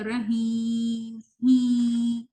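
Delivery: "رحیم" dragged out with a nasal "ہی"; wrong nasal hee. Shown as a demonstration. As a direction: stretch "ہی" through the nose, the wrong way. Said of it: "غلط ہے یہ". (غلط ناک والی "ہی")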